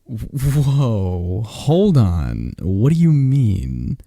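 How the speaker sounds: ordinary delivery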